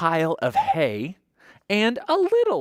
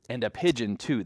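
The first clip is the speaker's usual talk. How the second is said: usual speaking voice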